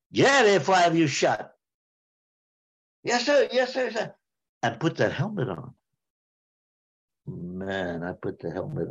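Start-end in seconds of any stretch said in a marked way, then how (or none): 0.1-1.5 fast, higher barked threat of an officer; angry officer. Retold or imitated. Major throwing the private out of the church, fast angry bark. 3.0-4.1 high, hurried flustered yes sir; scared yes sir. Retold or imitated. Private answering the furious major, high and flustered.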